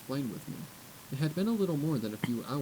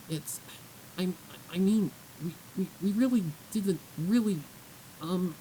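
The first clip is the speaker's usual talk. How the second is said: flustered stammer; 7 semitones higher than usual. Acted. Young filly stammering to the guard mare, flustered. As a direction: trip over the words, caught out.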